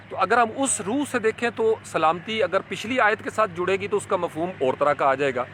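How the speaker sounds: usual speaking voice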